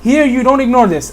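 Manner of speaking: ordinary talk, nothing marked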